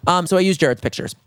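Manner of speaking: usual speaking voice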